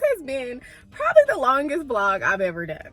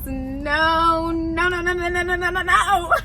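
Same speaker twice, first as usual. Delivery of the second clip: playful refusal; 6 semitones higher, much slower than usual. Real, not acted. Refusing to spoil the story.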